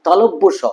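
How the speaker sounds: corrected "তালব্য স", ta-lob-bo with doubled b; সঠিক উচ্চারণ. Shown as a demonstration. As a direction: say "তালব্য স" carefully as ta-lob-bo sho. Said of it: "What is তালবেশ স?". ("তালব্য স" শুদ্ধ করে উচ্চারণ)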